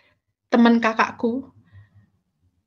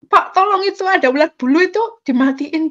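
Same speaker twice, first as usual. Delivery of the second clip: higher, louder urgent plea; retold frightened plea; 6 semitones higher, 6 dB louder than usual. Woman begs a man to kill the caterpillar, retold frightened plea. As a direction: re-enact begging for help in a fright. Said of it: "Saya itu sampai jerit ketakutan".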